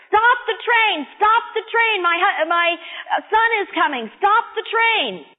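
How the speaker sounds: high, urgent mock yell; acted screaming. Mother to train dispatcher as train leaves, mock screaming. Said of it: "screaming at the train dispatcher".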